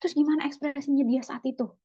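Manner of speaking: nosy quick question. Acted. Mock over-questioning friend.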